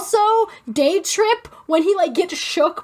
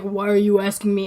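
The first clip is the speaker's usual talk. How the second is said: low, gruff retort; low Bellamy quote; 8 semitones lower than usual. Retold or imitated. Quoting Bellamy's low retort from the show. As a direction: drop low and brush her off. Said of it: "he's like".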